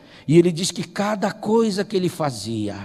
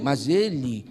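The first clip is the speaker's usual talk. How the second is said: ordinary delivery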